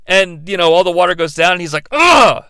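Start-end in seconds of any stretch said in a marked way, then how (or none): 1.9-2.4 high, exasperated "ugh"; frustrated grunt. Shown as a demonstration. Critic showing Aang losing the water, frustrated groan. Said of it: "Like, show the frustration".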